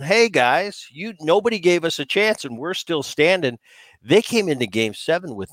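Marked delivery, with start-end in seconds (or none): 0.0-3.6 sarcastic taunt at the doubters. Retold or imitated. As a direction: players needling the doubters, sarcastic and high. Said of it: "kind of sarcastic way going".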